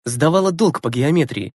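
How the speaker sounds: usual speaking voice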